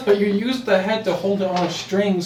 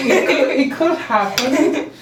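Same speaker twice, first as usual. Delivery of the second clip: laughing; 10 semitones higher, 4 dB louder, slower than usual. Real, not acted. Defending hope, friends laughing.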